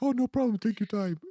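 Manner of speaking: high-pitched, chirpy mock nurse; high, easygoing imitated reassurance; imitated breezy nurse. Retells the nurse's breezy reply.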